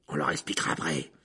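low, impatient growl. A narrator's reading. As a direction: growl it while pushing free.

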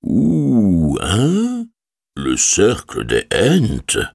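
a hint of an ancient tree voice; unhurried, rumbling old Ent. A narrator's reading. Treebeard voiced in story.

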